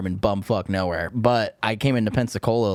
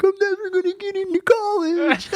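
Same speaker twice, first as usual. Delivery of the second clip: imitating a sobbing friend, much higher; mock crying wail; 4 dB louder than usual. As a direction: whine it like a teen crying in handcuffs. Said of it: "like, crying".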